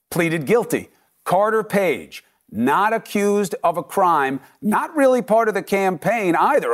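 normal talking voice

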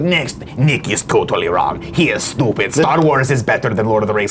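sneering troll reading out a hater's comment; Strong Bad voice. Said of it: "it's my internet commenter voice".

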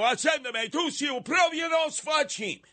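high shouted Neapolitan insult; Italian outburst. Retold or imitated. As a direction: bark it like an old Italian uncle.

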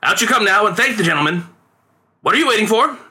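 high, impatient summons; father's theatrical call. A narrator's reading. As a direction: chivvy the boy out, voice lifted. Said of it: "he cried out".

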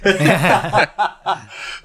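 high burst of laughter; real laugh. Real, not acted. Host laughs at the leaking pun, high laughter. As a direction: crack up, pitched high.

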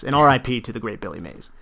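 usual speaking voice

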